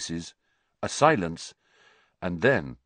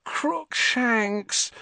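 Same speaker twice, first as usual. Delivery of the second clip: higher, drawn-out despairing moan. A narrator's reading. Hermione dreading the cat, moaning its name. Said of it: "Hermione moaned".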